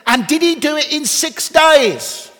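put-on high, loud, slow little boy's voice; child's question. Son on the train asking his father, loud child voice. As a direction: play the boy asking with wide-eyed wonder. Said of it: "And then he said".

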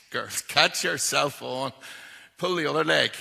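loud, slow laughing scoff; mocking laugh. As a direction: laugh off the women's tale. Apostles mocking the women.

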